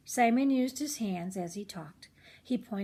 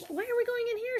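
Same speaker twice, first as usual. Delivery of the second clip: put-on much higher, puzzled kid voice; puzzled boy; faster than usual. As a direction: squeak it out like a confused little boy. Simon asks, puzzled.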